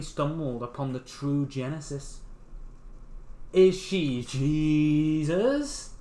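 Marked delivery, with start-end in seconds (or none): 3.5-5.9 very slow, louder, drawn out; slow drawn-out question. Real, not acted. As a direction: stretch it out in disbelief.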